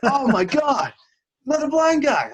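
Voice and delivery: very high, loud mock astonishment; amazed exclamation. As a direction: shout it like an astounded stranger. Stranger's amazed reaction in the story.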